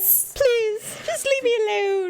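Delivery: high-pitched mock plea; pleading dog voice. Speaking for the offended dog to the vet, mock pleading. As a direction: beg in a put-on high voice for the dog.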